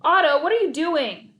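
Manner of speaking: high, loud coach scream; furious coach screaming at a player. A narrator's reading. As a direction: scream it in exasperation. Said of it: "he screamed".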